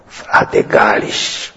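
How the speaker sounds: faint, hoarse, slow and half-whispered; weak hoarse voice. Retold or imitated. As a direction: rasp it out like a dying man. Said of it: "cu o voce foarte slabă și răgușită". (voce slabă și răgușită)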